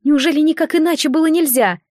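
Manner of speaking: high, lamenting cry; wailing. A narrator's reading. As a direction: wail it out in grief. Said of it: "причитала Матика".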